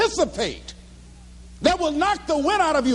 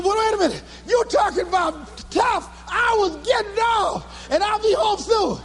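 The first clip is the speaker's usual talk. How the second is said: high, full-voiced crowing; excited bragging; 7 semitones higher than usual. Speaker boasts to girlfriend about his night, excited. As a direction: ride right over them, elated and high. Brags over girlfriend's serious words.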